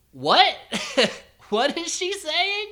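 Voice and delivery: high-pitched, incredulous question; bewildered, voice climbing; baffled. Retold or imitated. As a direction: shoot up high in amused confusion. Acting out baffled reaction.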